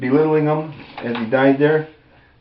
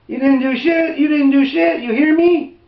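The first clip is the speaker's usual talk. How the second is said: high, rising sneer pushed louder; smartass taunt; 3 dB louder than usual. Retold or imitated. Deputy mocking the man on the ground, high sneering taunt. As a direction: needle him harder each time. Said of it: "like a smartass".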